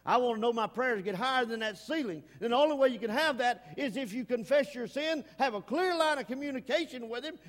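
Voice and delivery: preaching swell. Acted. Preacher urging the church to pray, rising. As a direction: lift it up to the rafters.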